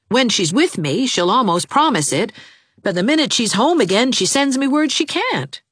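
lightly voiced eager young man; a hint of a lovesick young suitor, pleading. A narrator's reading. Jarvis's voice in the story.

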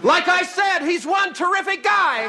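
high, loud, frantic praise; panicked shout. Acted. Praising jealous husband who follows her, panicked shout. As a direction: proclaim it at the top of your voice.

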